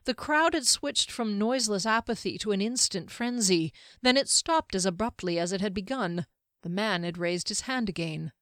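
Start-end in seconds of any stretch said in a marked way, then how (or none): none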